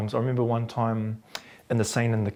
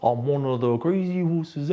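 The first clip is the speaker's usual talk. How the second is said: high, slow put-on Māori accent; 5 semitones higher, slower than usual. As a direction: overdo a Māori accent, pitched up. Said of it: "Find a Māori voice".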